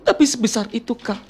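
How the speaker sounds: dramatic heartbroken lament; acted tearful breakup plea; fake crying. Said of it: "Coba acting nangis dulu". (tangisan patah hati yang didramatisir)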